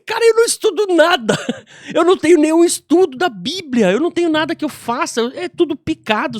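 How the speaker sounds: high, loud retold disclaimer; emphatic protest. Retells protesting against her praise.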